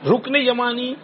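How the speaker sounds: usual speaking voice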